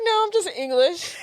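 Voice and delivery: high, giggly British imitation; British accent. Austin answering the Texan, laughing British accent. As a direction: put on English vowels while cracking up. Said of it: "he said it so British".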